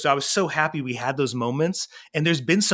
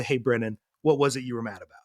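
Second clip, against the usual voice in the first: lower, easy comforting voice; gentle concern; 4 semitones lower, 3 dB quieter, faster than usual. Retold or imitated. Dad holding his crying son, gently asking. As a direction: ask softly, as to a child who has cried.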